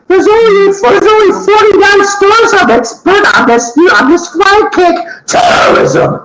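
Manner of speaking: high, hammed-up pitchman voice; a cartoonish ad announcer voice; mock ad announcer. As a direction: oversell it like a cheesy radio ad.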